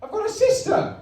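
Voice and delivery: very high Geordie astonishment; Luke Skywalker. Acted. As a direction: squeal it as a stunned Geordie son. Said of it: "I made him Geordie".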